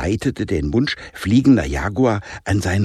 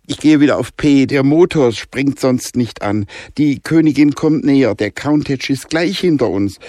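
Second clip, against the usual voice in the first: louder and higher, despairing; desperate shout; 6 semitones higher, 5 dB louder than usual. Acted. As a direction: yell in desperation as she closes in. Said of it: "schrie Jonah verzweifelt". (Jonah schreit verzweifelt)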